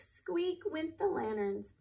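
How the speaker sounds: lively, expressive storybook reading; reading to children. Acted. Teacher reading a story to children, lively storybook voice. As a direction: read it out like a picture book for a class. Teacher reads picture book aloud.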